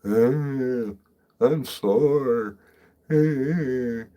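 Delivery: loud, high mock whining of sore friends; whiny sore groan. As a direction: ham up the sore friends' complaint. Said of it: "bitching about being sore".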